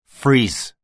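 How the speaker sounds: loud, high, sharp shout; bellowed command. A narrator's reading. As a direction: bellow it as an armed order. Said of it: "brüllte ich ihm zu".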